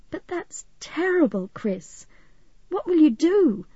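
high, distressed sympathy; upset, pitch lifted; dismayed. A narrator's reading. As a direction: react to a friend's terrible news. Friend reacts to devastating news.

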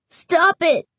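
put-on high, scared angry boy. A narrator's reading. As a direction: snap it out, fear underneath. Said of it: "His voice sounded angry".

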